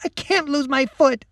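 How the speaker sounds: high, tearful plea; girl sobbing as she pushes the soldier away. Acted. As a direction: beg through tears. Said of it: "Lacey sobbed".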